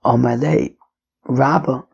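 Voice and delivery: usual speaking voice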